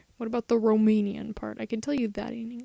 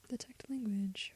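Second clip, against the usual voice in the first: whispering; 12 dB quieter than usual. Real, not acted. Muttering while searching.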